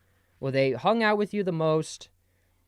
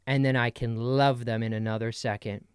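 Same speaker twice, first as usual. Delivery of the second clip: ordinary delivery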